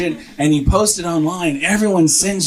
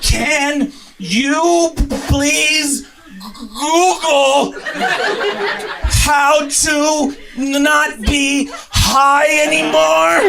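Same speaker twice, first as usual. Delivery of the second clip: desperate pleading; 12 semitones higher, much slower than usual. Retold or imitated. Stoned husband begging his wife, desperate. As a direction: stretch out every word begging.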